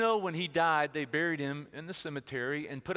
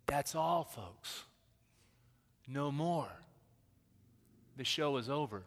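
mournful quote. Retold or imitated. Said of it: "the world's way of saying".

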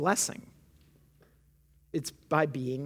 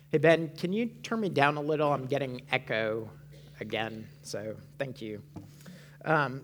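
ordinary delivery

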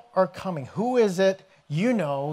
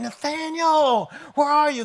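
yelling for Nathanael; 8 semitones higher than usual. Retold or imitated. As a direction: call out to a friend far off, stretching the name. Philip yelling down dirt road.

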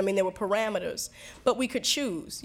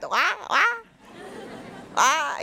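mock Jamaican patois; 8 semitones higher than usual. Woman mocks her fiance's talk, exaggerated Jamaican patois. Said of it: "this Jamaican path".

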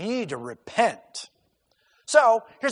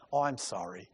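sheepish, much lower and softer mock sorry; meek apology; 7 semitones lower, 11 dB quieter than usual. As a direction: play the timid prophet backing down. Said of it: "No, that's not what he says".

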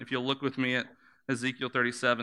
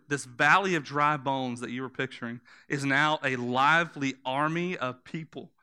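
normal talking voice

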